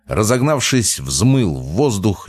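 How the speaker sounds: plain reading voice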